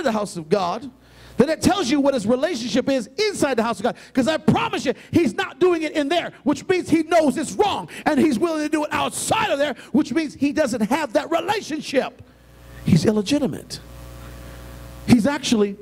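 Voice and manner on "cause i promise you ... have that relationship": raised, higher preaching voice. Real, not acted. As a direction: build it into a fiery preach. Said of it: "can I just preach for a second?".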